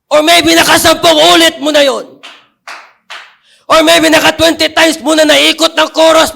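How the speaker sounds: rising preacher's crescendo; preaching. Acted. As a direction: build toward the chains falling off. Preaching builds to a climax.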